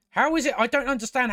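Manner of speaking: exasperated disbelief, pitched high; can't believe what she just said; incredulous. Real, not acted. Reviewer asks the show how it could be, incredulous.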